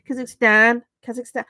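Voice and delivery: mock-nasal Brummie twang on Kazakhstan; nasal Brummie accent. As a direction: exaggerate the Brummie accent through the nose. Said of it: "like, really nasally".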